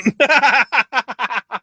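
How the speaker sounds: high, loud laughing burst; real high cackle; helpless laughter. Real, not acted. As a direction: crack up at your own joke. Cracks up at own joke.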